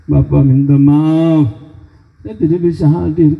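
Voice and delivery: slow, pleading mock apology; hammed-up slow apologetic whine; mock excuse. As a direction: imitate a lame churchgoer's excuse. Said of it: "Kita bikin alasan".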